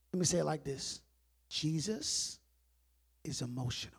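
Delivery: low, soft, weighty delivery; hushed point. Real, not acted. Preacher telling both sides Jesus is emotional, hushed. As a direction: drop your voice and let each phrase land.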